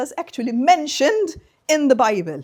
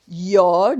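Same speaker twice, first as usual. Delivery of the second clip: ordinary talk, nothing marked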